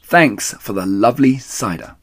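mild mocking thanks; cheeky. The foxes tease Rat, cheeky. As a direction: tease him as you go.

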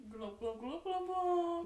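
loud burbling fish noises; gurgling fish man croak. Pretend. Playing Mister Fish.

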